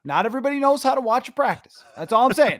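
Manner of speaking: high-pitched, quick, indignant defence; playful indignant rant. Real, not acted. Host egged on to dunk on reporters, indignant.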